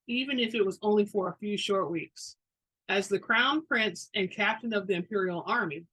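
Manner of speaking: plain reading voice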